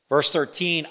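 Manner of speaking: normal talking voice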